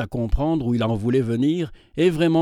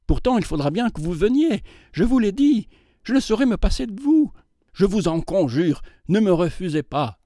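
higher, pleading and insistent; insistent suitor; 7 semitones higher than usual. A narrator's reading. Suitor begging mademoiselle not to refuse, higher insistent plea.

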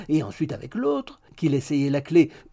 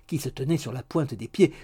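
usual speaking voice

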